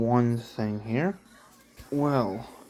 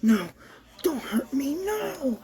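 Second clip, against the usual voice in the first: very high, breathy panicked cries; terrified pleading; 13 semitones higher than usual. Acted. Thompson fleeing the classroom from the killer, terrified high pleading. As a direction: beg for your life as she comes at you. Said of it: "cried Thompson in fear".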